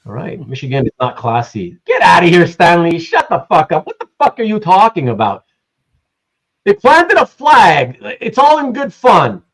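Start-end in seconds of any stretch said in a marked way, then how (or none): 1.9-5.5 loud, exasperated telling-off; indignant outburst. Real, not acted. Host rebuking a chatter over the flag remark, loudly indignant. 6.7-9.4 high, loud, drawn-out protest; exasperated protest. Real, not acted. Host defending the flag planting to a chatter, loudly exasperated.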